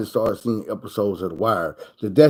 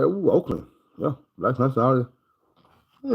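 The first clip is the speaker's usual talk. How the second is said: slow, drawn-out mock awe; mock impressed; 5 dB quieter, much slower than usual. Mocking awe at Oakland, slow and drawn out. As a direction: fake being impressed. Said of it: "I'm like".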